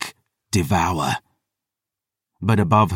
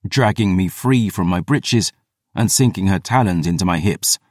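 ordinary delivery

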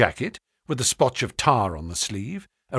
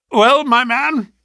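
slow, high hearty greeting; host voice; 12 semitones higher, 7 dB louder, slower than usual. A narrator's reading. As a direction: greet him grandly and slowly. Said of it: "said he".

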